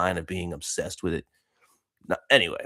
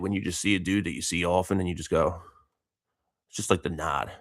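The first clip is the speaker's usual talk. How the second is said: usual speaking voice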